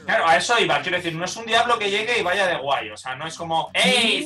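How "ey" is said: put-on a cocky devil with a Mexican accent; brash Mexican-accented greeting. A show-off devil greets Moscow in a Mexican accent. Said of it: "¿Por qué habla mexicano".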